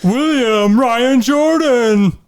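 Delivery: slow, high game-show announcer. Pretend. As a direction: roll the names out like a big host intro. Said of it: "I can't with your voice".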